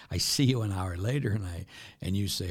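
usual speaking voice

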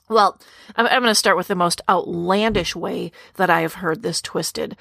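normal talking voice

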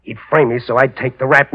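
ordinary delivery

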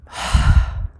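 long breathy exhale; deep breath out. Shown as a demonstration. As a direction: breathe out slowly and fully. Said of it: "just breathing. I want you to try that".

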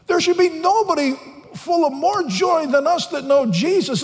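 high, loud, ringing pulpit voice; fiery preaching. Acted. Preacher urging the congregation toward joy, full force, loud and high.

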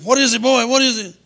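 high, loud shout at the door; alarmed holler. Acted. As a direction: holler it as if roused from bed. Woken family answers pounded door.

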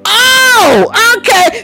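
long, high, loud "oh"; dawning realization. Retold or imitated. Preacher mimics finally understanding the message, exclaimed realization. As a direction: cry out as the penny drops. Acting out the moment it clicks.

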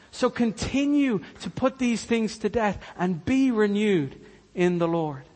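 ordinary talk

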